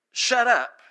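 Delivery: mock disciples' put-down; curt rebuke. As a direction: shush an outsider firmly. Disciples telling an outsider off.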